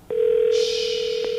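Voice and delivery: drawn-out shushing sound; sustained unvoiced shush; long hush. Real, not acted. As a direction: hush the room with a long shh. Hushing while the call connects.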